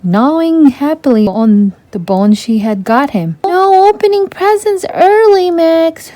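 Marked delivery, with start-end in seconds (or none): 3.5-6.1 much higher, playful scold. A narrator's reading. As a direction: tell off a naughty dog fondly. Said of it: "she scolded".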